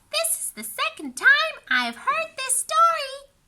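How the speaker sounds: put-on high little girl voice; chatty girl. A narrator's reading. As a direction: greet brightly like a chatty kid. Wanda voiced by narrator.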